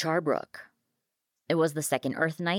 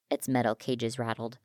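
ordinary delivery, nothing marked

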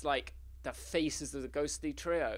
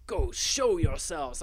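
menacing ghost hunter; 4 dB louder, slower than usual. Acted. Eradicator demands the ghosts appear.

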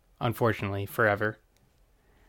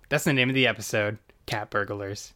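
usual speaking voice

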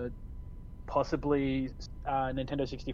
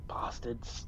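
whispered; 3 semitones lower, 8 dB quieter than usual. Retold or imitated. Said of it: "and then it's like".

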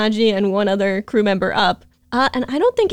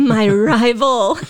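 dramatic rival; 4 dB louder, much slower than usual. Retold or imitated. Mocking Kiara's obsession.

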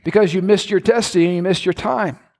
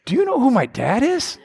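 David's high, swooping challenge; swagger; 6 semitones higher than usual. Acted. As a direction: taunt with proud swag. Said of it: "David said".

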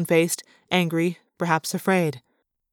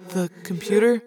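hesitant little question; timid. A narrator's reading. Arbron reminds the prince of his request, timidly. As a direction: tentatively float the reminder. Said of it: "Arbron asked timidly".

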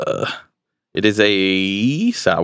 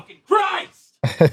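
slow, very high exasperated cry; exasperated yell; much slower than usual. Real, not acted. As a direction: stretch out the outburst. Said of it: "My yelling".

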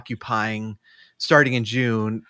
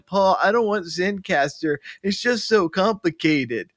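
higher, whining impression; teasing imitation of grumbling friends; mock whiny complainers; 6 semitones higher than usual. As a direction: whine it like a reluctant co-host. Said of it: "That's my impression of them".

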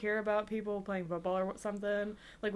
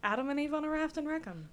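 diner cook calling orders; 6 semitones higher, 4 dB quieter, faster than usual. Acted.

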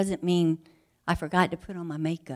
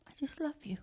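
softer tender whisper; 6 semitones higher, 10 dB quieter than usual. Acted. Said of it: "he says".

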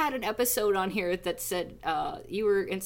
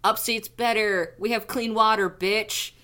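mock upstate snob; 8 dB louder, slower than usual. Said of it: "New Yorker people are gonna be like".

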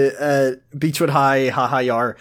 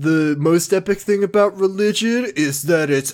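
higher, brash bro voice; dude bro voice; 5 semitones higher, slower than usual. Pretend. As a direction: play it as a cocky bro. Imitating Kyle dismissing religion.

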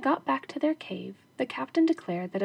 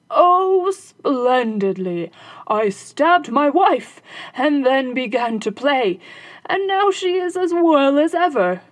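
loud, high, slow gloating robber captain; boastful captain; 4 semitones higher, 9 dB louder, slower than usual. Acted. Captain boasting falsely to his robber, gloating. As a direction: lie with triumphant relish.

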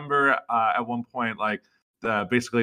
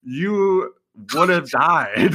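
louder, drawn-out comeback; mock blunt rebuttal; 5 semitones higher, 5 dB louder, much slower than usual. Answering an actor's boast of heroics, mock rebuttal. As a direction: stretch it out to puncture a boast. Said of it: "it was like".

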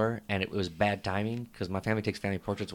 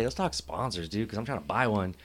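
usual speaking voice

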